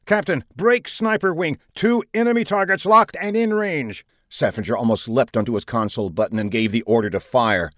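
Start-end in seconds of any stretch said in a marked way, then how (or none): 0.1-4.1 urgent sniper report. A narrator's reading. Sniper reports targets locked.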